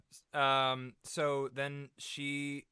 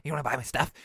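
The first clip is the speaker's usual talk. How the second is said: hard sell; 7 dB louder, faster than usual. Retold or imitated. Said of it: "The hard sell".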